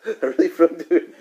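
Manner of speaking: slow, high words through a laugh; laughing aside. Real, not acted. Teacher describing the author to students, laughing.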